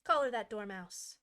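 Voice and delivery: loud shrieking command; shrieked. A narrator's reading. Queen orders the dormouse seized, shrieking loud. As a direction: bark the order at full volume. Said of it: "the queen shrieked".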